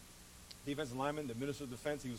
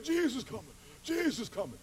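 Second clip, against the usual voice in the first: raspy Big Dog voice; 8 semitones higher, 3 dB louder than usual. Retold or imitated. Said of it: "he had a real raspy voice like that".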